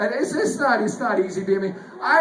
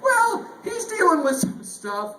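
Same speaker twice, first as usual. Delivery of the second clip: higher, slow, careful coworker voice; detailer's hesitant tone; 10 semitones higher than usual. Retold or imitated. Coworker explaining an absence, slow and higher. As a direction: ease into the bad news haltingly. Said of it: "He goes".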